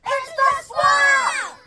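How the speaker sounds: very high, slow, loud shout; slowed storytime shout for the class to join. Shown as a demonstration. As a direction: stretch out "It's the Swamp!" as a long yell. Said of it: "Say it with me".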